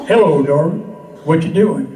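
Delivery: low, loud he-man sweet talk; hammed-up manly come-on; most manly voice. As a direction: drop deep and loud, playing the big manly charmer. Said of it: "in my most manly voice".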